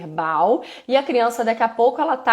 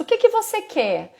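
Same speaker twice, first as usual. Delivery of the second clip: much higher, sing-song question; parent asking child; 10 semitones higher than usual. Shown as a demonstration. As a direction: coax the child to name it. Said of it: "É quando você pergunta pra criança".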